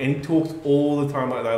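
ordinary delivery

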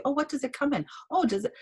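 high, lilting acted questions; curious customer. Customer asking seller about the product, eagerly curious.

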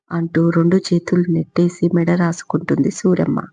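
ordinary delivery, nothing marked

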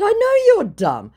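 high, loud comeback; scornful retort. Retold or imitated. Woman answering the scammer's protest, scornful. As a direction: throw it back emphatically.

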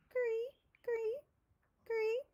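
very high chirping cree; cricket chirp. A narrator's reading. Crickets in the grass, high chirping imitation.

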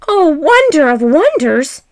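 usual speaking voice

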